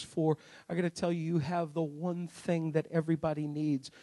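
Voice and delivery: low, soft and hushed; quiet preaching. Acted. Preacher softly drawing in the congregation, low and hushed.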